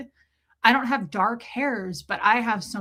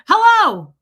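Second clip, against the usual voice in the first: loud, high call into a phone; shouted hello; 10 semitones higher, 13 dB louder than usual. Retold or imitated. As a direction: holler to a caller who may not hear. Calling into soaked phone.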